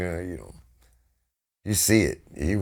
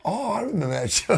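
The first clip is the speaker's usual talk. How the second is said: laughing recognition; 11 semitones higher than usual. Retold or imitated. Laughs recalling drunk show.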